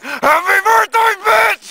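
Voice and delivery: high, drawn-out full-voice holler; drunk dad yelling. Acted. Son acts out dad storming in on his birthday, yelling. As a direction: bellow it in a high, sustained yell.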